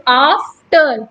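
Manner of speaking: after split in two, leaning hard on "af"; slow after, stressed "af", unstressed "ter"; stress on first syllable. Shown as a demonstration. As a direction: push the first syllable of after, let the second go. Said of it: "आफ बोलने में हमें stress".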